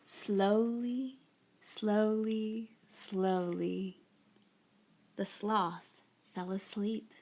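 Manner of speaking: slow, soft storybook narration; unhurried picture-book reading, softly spoken; story read to children. A narrator's reading. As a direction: draw out each phrase gently, leaving long pauses.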